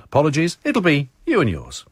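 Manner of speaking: ordinary delivery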